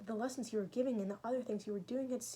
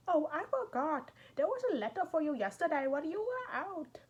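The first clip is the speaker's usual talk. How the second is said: the maid Natasha, teasing and alarmed, pitched high; high maid's voice; 8 semitones higher, 5 dB louder than usual. Acted. Natasha the maid fussing at Raskolnikov, high teasing voice. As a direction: play the chatty maid, voice lifted well above your own.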